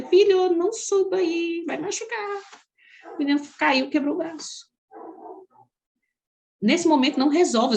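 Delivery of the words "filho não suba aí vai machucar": warning son. Retold or imitated. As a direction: call up to the boy before he climbs. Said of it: "eu falei". (aviso aflito ao filho, bem mais agudo)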